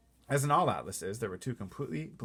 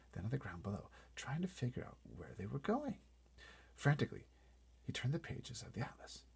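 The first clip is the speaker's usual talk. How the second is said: plain reading voice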